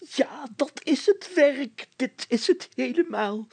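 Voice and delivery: high, slow elated exclaim; delighted call. A narrator's reading. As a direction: cry out in rapture. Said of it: "riep de burgemeester opgetogen". (opgetogen uitroep)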